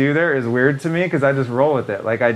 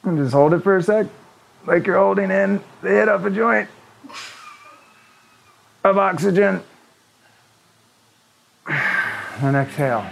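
guided breathing cue; 7 semitones higher than usual. Shown as a demonstration. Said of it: "And then a big inhale".

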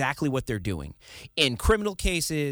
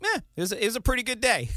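higher, dismissive mimic of a suddenly pleased Trump; mock Trump shrug; 9 semitones higher, faster than usual. Host mimicking Trump, who suddenly sounds content about court, higher and dismissive. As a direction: shrug it off like the boss after a good day. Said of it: "he's like".

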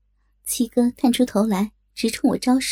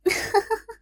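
short high giggle; a flirty little laugh; light rising laugh; 11 semitones higher, 3 dB quieter than usual. A narrator's reading. Li laughs at Lao Chen's request, a high giggle.

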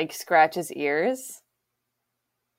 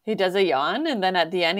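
ordinary delivery

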